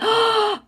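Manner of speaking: sharp, high delighted gasp; delighted intake of breath; surprised gasp. Real, not acted. Reacting to a viewer's cat's name.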